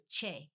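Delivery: che with CH and long A; Spanish che. Shown as a demonstration. Summing up for learners, che as CHAY. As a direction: say che as 'chay'. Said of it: "a C H and a long A".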